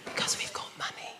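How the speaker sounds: whisper. Acted. Mock posh low talkers.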